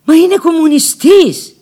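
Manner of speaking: high, loud indignant objection; sharp protest. Retold or imitated. She protests to the man, high and loud. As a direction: fling the objection back at him. Retold angry quote in story.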